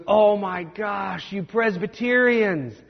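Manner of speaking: slow, drawn-out amused groan; laughing exclamation. Retold or imitated. Professor laughing at his Presbyterian student, drawn out. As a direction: draw it out through a laugh. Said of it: "he starts laughing and he goes".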